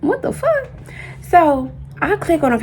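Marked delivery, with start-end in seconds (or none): 0.0-0.7 sudden very high disbelieving cry; high-pitched what-the-fuck of disbelief; shocked squeal. Retold or imitated. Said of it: "So I'm like".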